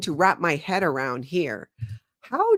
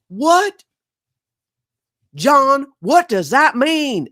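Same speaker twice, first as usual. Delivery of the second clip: high, loud Southern shocked cry; mock offended Southern billionaire; Southern accent, shocked; 10 semitones higher, 9 dB louder, much slower than usual. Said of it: "these accents".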